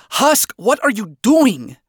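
high, drawn-out exasperated question; annoyed. A narrator's reading. Vaggie catching Husk drinking, annoyed. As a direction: ask in open annoyance. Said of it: "Vaggie asked in annoyance".

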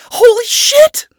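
high, loud shriek of shock; startled yell. A narrator's reading. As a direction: yell out in sudden panic. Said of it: "she yelled".

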